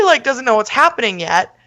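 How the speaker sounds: ordinary talk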